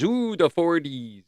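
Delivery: slow, drawn-out mock intrigue; playful slow ooh; mock wonder. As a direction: savor it like a mystery. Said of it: "mock wonder".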